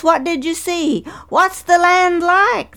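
high, eager questions, slowing at the end; hungry for news of the land; eager cry. Acted. Families questioning spies, high and eager. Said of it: "they cried".

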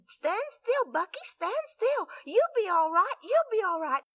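high, frightened pleading to keep still; scared reassurance. Acted. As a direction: soothe him while panicking yourself. Scared sister calming bleeding brother.

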